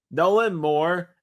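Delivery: ordinary talk, nothing marked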